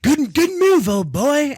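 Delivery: put-on high posh English old gent cheering; English old man accent. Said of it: "you need to have an English old man accent".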